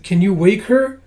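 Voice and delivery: high, loud, anxious plea; pleading question. Acted. Kippa begs help for her friend, pleading.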